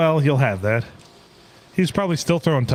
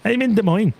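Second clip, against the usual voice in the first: put-on Indian accent; 8 semitones higher than usual. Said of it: "in an Indian accent".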